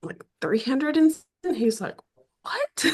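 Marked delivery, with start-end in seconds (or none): 2.4-2.8 much higher, shocked "what?"; a stunned husband who can't believe it; floored husband. Retold or imitated. Husband hearing the book runs three hundred pages, floored and much higher. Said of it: "He was just floored".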